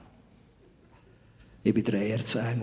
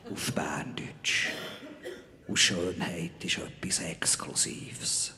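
soft unvoiced whisper; hushed exclusive confidence; 6 semitones lower, 7 dB quieter than usual. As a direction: lean in and whisper it like a secret. Confiding secret beauty of dialect.

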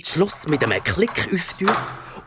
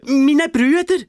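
put-on high, slow, louder child voice; small boy, higher and louder; 10 semitones higher, 6 dB louder, slower than usual. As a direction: complain like a wronged little boy. Boy names his captors.

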